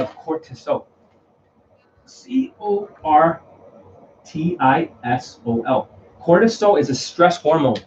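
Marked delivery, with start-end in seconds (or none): 2.1-5.9 letter by letter, slowly and carefully; spelling it out slowly. Shown as a demonstration. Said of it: "I'm not sure if I can spell it correctly".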